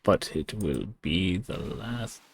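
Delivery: very slow, hushed echo of a threat; sinister line in slow motion; slow, fading threat. Acted. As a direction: draw it out softly, word by word. Threat repeated slowly, fading.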